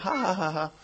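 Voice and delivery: high taunting ha ha ha; mock laughter. Goat laughing at the goose, mocking ha ha. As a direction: cackle at the goose. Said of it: "die Ziege hat sie ausgelacht".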